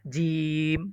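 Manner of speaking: normal talking voice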